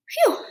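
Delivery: high playful exhale of relief; mock relieved phew. Shown as a demonstration. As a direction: blow out a breath after the long line. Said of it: "that's a really long tagline".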